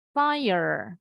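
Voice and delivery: American accent, fai-yer with a curled R; American pronunciation. Shown as a demonstration. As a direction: Fire with an American R sounded at the end. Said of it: "orang Amerika masih bunyi R-nya". (Fire logat Amerika, R di akhir berbunyi)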